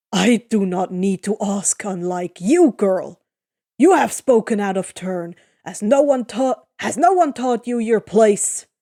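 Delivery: growling. Acted. Eurylochus growls at Annabeth to know her place, loud. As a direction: growl down at the girl. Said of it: "Eurylochus growls".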